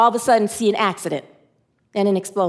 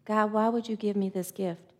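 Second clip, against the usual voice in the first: much softer anguished plea to God; softly asking God why, in grief; despairing; 3 semitones lower, 11 dB quieter than usual. Real, not acted. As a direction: ask God, broken and quiet.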